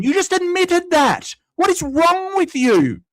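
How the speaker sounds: furious, voice climbing with disbelief; angry rant. Real, not acted. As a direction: streamer yelling at a troll in chat. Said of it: "Sorry, rant over".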